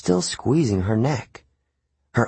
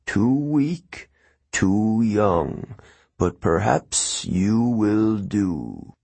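amused ancient voice; much slower than usual. A narrator's reading. Pit voice continues.

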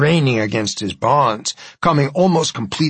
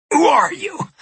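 frightened shout. Acted. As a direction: yell out in fear. Harding cries out in fear.

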